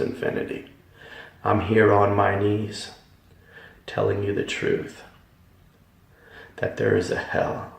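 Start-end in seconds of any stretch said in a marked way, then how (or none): 3.9-7.7 hushed earnest. Real, not acted. Earnest testimony on his knees.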